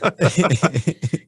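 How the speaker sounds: bursting out laughing, high and loud; a big real laugh at himself; loud amused laugh. Real, not acted. Laughs at own audio story.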